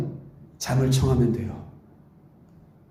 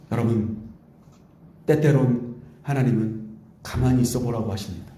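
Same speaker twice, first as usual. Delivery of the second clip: usual speaking voice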